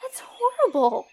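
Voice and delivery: high, loud appalled reaction; horrified. Acted. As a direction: exclaim in sympathetic dismay. Horrified at parents' plot.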